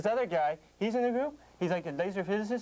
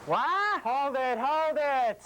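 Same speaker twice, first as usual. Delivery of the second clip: sudden loud high cry to halt the act; stop shout; 7 semitones higher, 4 dB louder, much slower than usual. Acted. Agent cutting off the performers with a loud stop. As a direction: yell to cut off the kids' skit.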